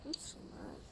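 breathed whisper, no voice at all; defeated whisper. Real, not acted. Gamer whispering to viewers after losing the fight, defeated. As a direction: whisper it, drained after the loss.

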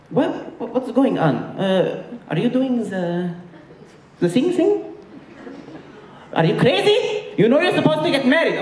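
put-on very high, accented scolding; outraged Egyptian mom. Mom scolding son about the girlfriend, high outraged voice. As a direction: mom exploding at her son.

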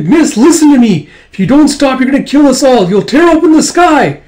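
very high, loud frantic plea; desperate pleading shout. Acted. Kippa begging miss to stop, frantic high shout. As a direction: beg at the top of your voice.

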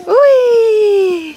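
squealed ooh, very high and loud. Real, not acted. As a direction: squeal in surprise. Sandform bricht. (hohes, lautes Ui)

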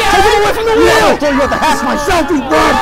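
furious yelling. Acted. Crewman raging at the captain crashing the ship, furious screaming. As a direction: scream him away from the wheel.

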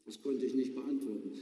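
ordinary delivery

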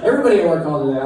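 normal talking voice

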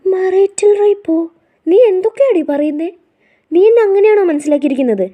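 a husband reeling at a wounding accusation; shocked disbelief. Acted. Groom stunned at his bride's words, high shocked outcry.

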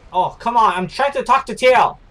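real irritated shout; annoyed outburst. Real, not acted. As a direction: snap at the game for interrupting. Interrupted while chatting, annoyed.

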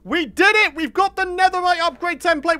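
loud, high victory shout; triumphant cheer. Real, not acted. Player finds the template and cheers, loud triumphant shout. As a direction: cheer like you just won.

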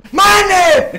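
loud high angry shout; frustrated yell. Real, not acted.

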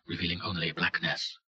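ordinary delivery

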